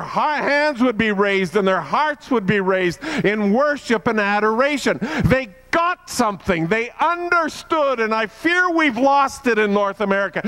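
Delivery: high, loud, ringing preacher's cry; full-force preaching. Acted. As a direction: lift the congregation with a soaring call to worship. Preacher at climax of sermon.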